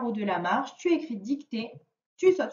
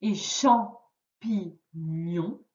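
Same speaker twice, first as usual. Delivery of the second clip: "Et champignon" very slow, a gap between syllables; "champignon" spaced out for counting syllables; split by syllables; 5 semitones lower, much slower than usual. Shown as a demonstration. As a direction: break "champignon" into three careful beats. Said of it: "Dernière syllabe".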